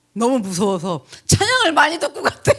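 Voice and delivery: words breaking into laughter; laughing while telling. Real, not acted. Mother laughing about her son's scared hymns. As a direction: laugh through the story. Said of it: "나 진짜 우리 아들 엽기적이야".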